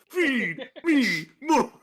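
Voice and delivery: put-on slow demanding kid. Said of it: "[name] has a chubby kid".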